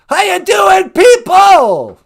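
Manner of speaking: high, shouted rock frontman greeting. Acted. Introduced to the audience, over-the-top stage shout. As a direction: scream hello to an arena crowd.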